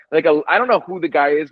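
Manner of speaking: normal talking voice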